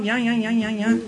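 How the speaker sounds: high, rapid-fire mock yammering; devil's crazy performance. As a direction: imitate a demon acting up, pitched high. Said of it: "doing all kinds of crazy stuff".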